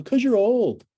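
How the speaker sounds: put-on high, loud teasing student voice, "'Cause you're old"; mocking students. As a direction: toss it off like a cheeky student. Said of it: "my students go".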